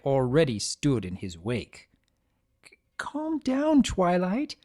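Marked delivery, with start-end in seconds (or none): 2.6-4.5 high, slow, stammering plea; a cornered little dragon begging; frightened pleading. Acted. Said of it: "he pleaded".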